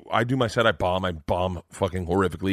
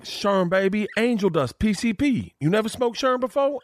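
high-pitched put-on voice, incredulous; Tracy Morgan; 11 semitones higher than usual. Celebrity explaining angel dust to a novice, incredulous. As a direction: imitate him explaining it in a high, insistent voice. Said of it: "And he's like".

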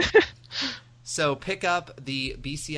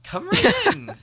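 excited fan greeting; 7 semitones higher, 7 dB louder, slower than usual. Retold or imitated. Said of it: "They'll be like".

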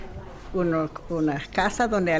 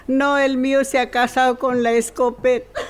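louder, laughing through the punchline; 5 semitones higher, 6 dB louder than usual. Acted. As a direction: crack up while delivering the last line. Punchline of a joke.

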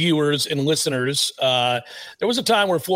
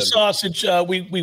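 ordinary talk

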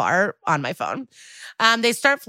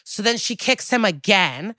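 normal talking voice

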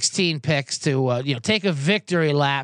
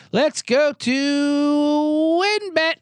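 announcer intro; 9 semitones higher, much slower than usual. Acted. Starting the ad read.

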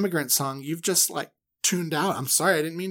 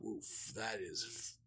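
slow awed Moses; 4 semitones lower, 4 dB quieter, slower than usual. Retold or imitated. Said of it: "And he goes".

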